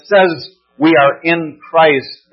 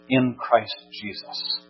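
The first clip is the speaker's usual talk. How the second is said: ordinary delivery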